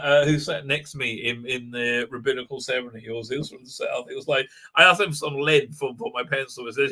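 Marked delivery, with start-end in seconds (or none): none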